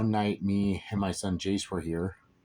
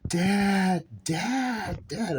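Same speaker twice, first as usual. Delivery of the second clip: high, drawn-out cry for Dad; high-pitched mock scream; retold scream; 11 semitones higher, much slower than usual. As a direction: imitate a child screaming out, pitched way up. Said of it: "and start screaming".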